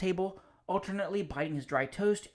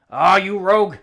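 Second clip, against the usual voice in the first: booming, much louder, fond scolding; hearty call to the dog; 3 semitones higher, 15 dB louder than usual. Acted. Visitor scolds his dog at full voice. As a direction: bellow playfully at the dog. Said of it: "He always spoke at the top of his voice".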